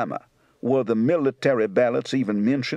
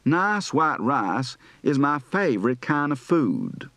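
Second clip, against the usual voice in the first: General Southern accent; slower than usual. Shown as a demonstration. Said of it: "General Southern".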